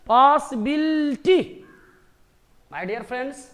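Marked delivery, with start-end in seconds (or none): 0.1-1.6 "पॉसिबिलिटी" slow and loud, long पॉ and बिल; stretched syllables. Shown as a demonstration. Teacher wrapping up the count, "पॉसिबिलिटी" slow and loud. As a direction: draw out "पॉसिबिलिटी" loudly, beat by beat. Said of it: "पाँच हो गए ना".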